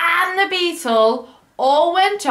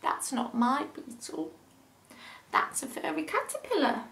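put-on much softer, gentle boy's voice; 12 dB quieter than usual. Billy tells the elephant it is not his beetle, soft. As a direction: say it quietly like a small boy. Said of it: "said Billy".

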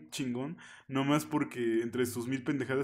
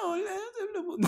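very high, laughing falsetto woman imitation; man doing a woman's voice; 4 dB quieter, faster than usual. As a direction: squeak it out like a woman, mid-laugh. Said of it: "Cuando intenta hacer voz de mujer hace".

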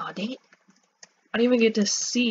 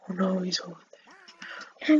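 slow frightened whisper; 4 dB quieter, slower than usual. Real, not acted. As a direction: whisper so the seeker won't hear. Hiding from seeker.

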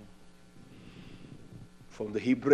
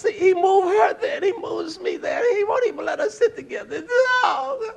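much higher, laughing half-cry complaint; mock whiny women; 14 semitones higher, 3 dB louder than usual. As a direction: ham up the women's tearful griping. Women mockingly voiced complaining.

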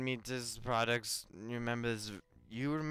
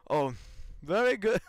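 a lightly raised posh hero voice; mock noble He-Man, a bit higher; 7 semitones higher, 3 dB louder than usual. Gamer showing how his hero talks, in a mild posh voice. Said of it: "he talks like this".

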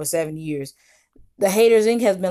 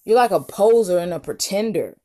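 ordinary delivery

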